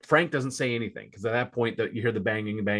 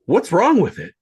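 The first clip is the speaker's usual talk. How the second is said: worried; 6 semitones higher, 7 dB louder than usual. Acted. Freddy's line in script read.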